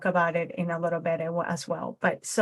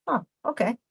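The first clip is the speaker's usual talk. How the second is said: dismissive; 2 semitones higher than usual. Retold or imitated. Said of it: "other ways that he could have also been dismissive".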